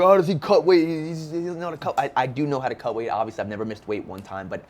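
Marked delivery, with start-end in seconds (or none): 0.0-2.0 mock critics. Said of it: "everyone's like".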